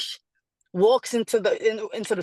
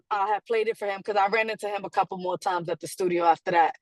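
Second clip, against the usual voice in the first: ordinary talk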